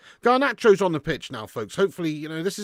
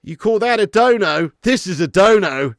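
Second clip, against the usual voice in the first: loud, higher showman's challenge; mock boast; 4 semitones higher, 9 dB louder than usual. Streamer jokes about a bigger donation, mock boasting. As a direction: crow it loudly before cracking up.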